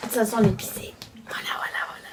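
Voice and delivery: hushed unvoiced whisper. Real, not acted. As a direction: breathe the words out without voice. Repeats answer, then whispers it.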